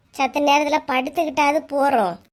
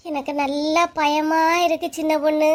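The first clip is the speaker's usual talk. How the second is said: ordinary talk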